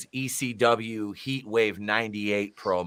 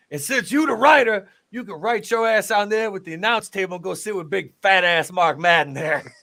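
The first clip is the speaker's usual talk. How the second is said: much higher, louder brash taunt; hammed-up imitation of a loud trash-talker; mock Ernest Miller; 9 semitones higher, 7 dB louder than usual. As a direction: rag on him like a cocky wrestler. Quoting Ernest Miller on commentary.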